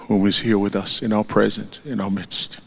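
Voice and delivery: normal talking voice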